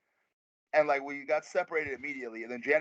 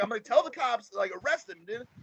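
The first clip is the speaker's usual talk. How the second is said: upset; 9 semitones higher than usual. Retold or imitated. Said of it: "He's like".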